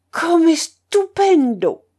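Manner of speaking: loud, high, drawn-out Italian exclamation; rapturous admiration. A narrator's reading. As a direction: marvel grandly like an old opera singer. Old singer raves about tenor.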